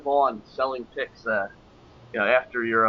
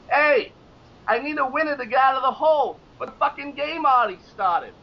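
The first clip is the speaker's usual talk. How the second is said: fake New York accent; 12 semitones higher than usual. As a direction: play a brash New Yorker. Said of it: "giving this fake New York accent".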